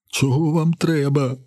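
high, slow, crying plea; tearful. A narrator's reading. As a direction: plead helplessly in a drawn-out high voice. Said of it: "Тепер він плаче". (плаксиво, високо й протяжно)

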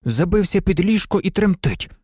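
plain reading voice

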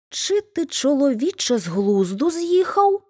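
shocked hedgehog wife crying out at her husband; cry out in alarm. A narrator's reading. Hedgehog wife cries out at her husband's plan, shocked and high. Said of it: "скрикнула їжачиха".

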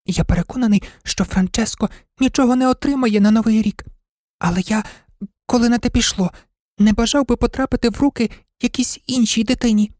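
high-pitched, hesitant little dog voice; timid toy dog. Acted. Toy dog airing his thought to the shop-window toys, timid high voice. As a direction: speak up shyly, high and haltingly.

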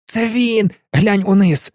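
put-on a boy squealing at the vanished legs; excited squeal. A narrator's reading. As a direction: squeal it out at the sight. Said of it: "і Рон аж завищав".

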